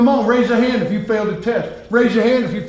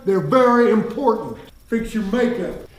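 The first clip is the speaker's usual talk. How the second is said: ordinary talk, nothing marked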